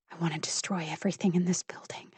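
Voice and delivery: fast, hushed menace; seething whisper. A narrator's reading. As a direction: hiss the fantasy out quickly. Seething destructive fantasy.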